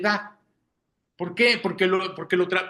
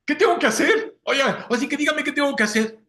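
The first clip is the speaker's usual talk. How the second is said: higher, pleading jailer with a laugh; desperate jailer; 6 semitones higher than usual. Retold or imitated. Jailer begging Paul, higher pleading voice. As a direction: beg like the shaken jailer, half laughing. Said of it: "le dice a Pablo".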